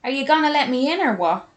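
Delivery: fast, high, loud Dublin holler; yelled North Side Dublin. A narrator's reading. May outside wanting to be let in, Dublin yell. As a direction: shout it impatiently in thick Dublin. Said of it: "she yelled in her thick North Side Dublin accent".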